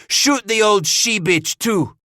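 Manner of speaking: high, loud, slow enraged command; furious roar. A narrator's reading. Henri orders the matriarch shot, roaring in fury. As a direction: roar it out, stretching the insult. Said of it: "Henri roared in fury".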